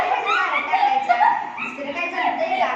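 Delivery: high laughing taunt; mocking laugh. Headman mocking the quarrelling women, laughing taunt. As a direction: laugh at them while scolding.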